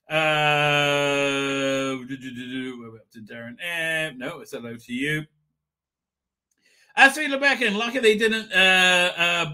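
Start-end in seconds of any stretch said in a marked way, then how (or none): none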